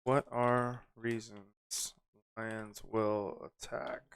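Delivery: slow and soft, with gaps; typing aloud. Real, not acted. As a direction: murmur it to yourself as you type. Types the question while saying it.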